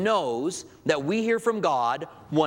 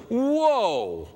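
startled whoa; 7 semitones higher than usual. Retold or imitated. Peter reacts to Jesus' death talk.

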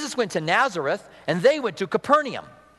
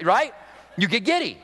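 ordinary talk

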